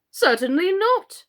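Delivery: high, loud, firm refusal; very decided. A narrator's reading. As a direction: shut it down flatly. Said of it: "said very decidedly".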